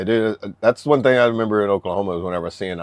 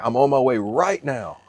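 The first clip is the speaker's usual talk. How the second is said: higher, louder mock drill instructor; hammed-up stern order; drill sergeant voice; 6 semitones higher, 4 dB louder than usual. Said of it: "It's like".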